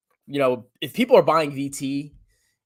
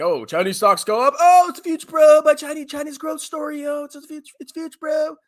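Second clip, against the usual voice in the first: high, loud bro-hype caricature; mock hyped bull; 13 semitones higher, 6 dB louder than usual. Vlogger mocking hyped China-stock bros, high excited caricature. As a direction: pump it up like a giddy China bull.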